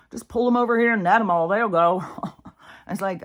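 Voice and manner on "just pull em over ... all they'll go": louder, higher hammed-up call; mock gleeful shout. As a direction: bark it like a fisherman hauling in the catch. Mocking fishermen netting people.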